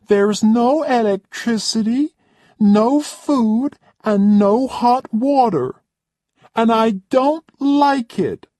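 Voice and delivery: haughty high-pitched complaining lady; spoilt rich guest. Acted. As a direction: play the pampered diva who expects service. Roxanne's complaining speech.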